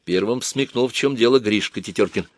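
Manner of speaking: usual speaking voice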